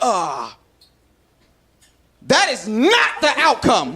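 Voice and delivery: slow, stiff, professorial phrasing; a dry lecturer stalling in a tense moment; slow formal reply. Retold or imitated. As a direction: speak like a stiff presenter. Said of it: "So I was like".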